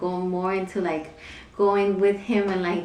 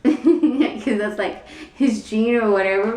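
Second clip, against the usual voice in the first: laughing; 3 semitones higher, 5 dB louder than usual. Real, not acted. Laughing about husband's spice tolerance.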